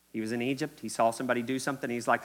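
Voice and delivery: usual speaking voice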